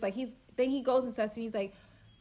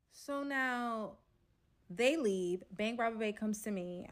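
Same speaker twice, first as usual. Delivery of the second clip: usual speaking voice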